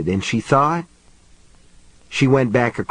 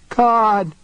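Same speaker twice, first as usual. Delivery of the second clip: thin, high-pitched cry of horror; high, strengthless scream; 11 semitones higher than usual. A narrator's reading. Woman seeing piled bodies, screaming high and weak. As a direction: scream it high without real power. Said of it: "she screamed in a high, strengthless voice".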